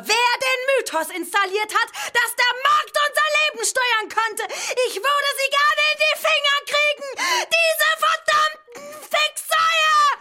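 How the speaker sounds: steigernd zum hohen, lauten Kreischen; wütend hinauskreischen; hysterischer Ausbruch. Acted. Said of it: "Es scheint ihr wirklich ernst zu sein". (sich hysterisch in Rage schreien)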